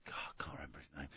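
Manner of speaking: whispered aside to self; muttered under breath; whispering. Real, not acted. Muttering to himself, forgetting name.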